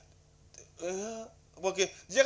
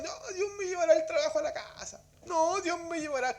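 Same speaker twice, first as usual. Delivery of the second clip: lightly mocking believer; mocking lazy believer; 8 semitones higher than usual. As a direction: parody their lazy excuse, pitched up. Mocking lazy Christians' excuse.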